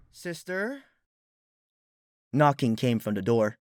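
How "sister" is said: soft, muffled sleepy call. A narrator's reading. Celestia asks through the door, soft and sleepy.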